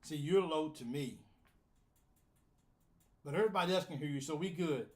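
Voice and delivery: ordinary talk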